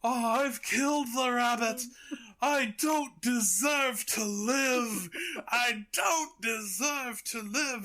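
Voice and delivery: high, loud cartoon anguish; hammed-up wail. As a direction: drag out each word in mock despair. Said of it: "screams out like he's dead".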